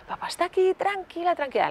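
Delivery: high-pitched, anxious-to-calm father; flustered soothing dad. Retold or imitated. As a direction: imitate a dad hurriedly soothing his girl. Imitates nervous father soothing daughter.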